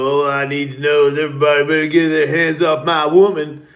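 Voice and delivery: hammed-up possessive boyfriend; mimicking a tripping guy warning everyone off; mock macho boast. Drunk guy at fire boasting.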